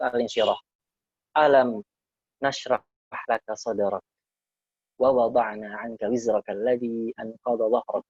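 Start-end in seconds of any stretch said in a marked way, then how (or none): 1.4-8.0 measured, lightly intoned recitation; Quran recitation. Acted. Teacher reciting Surah Al-Insyirah to students, intoned. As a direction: recite it like reading from the mushaf.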